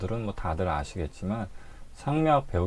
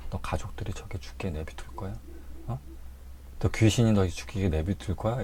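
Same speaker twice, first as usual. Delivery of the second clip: whispered goading, much softer; urging whisper; 6 dB quieter than usual. Retold or imitated. Bystanders goading the patient, whispering urgently. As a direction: egg them on in a hushed whisper. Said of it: "이렇게 막 부추겨요".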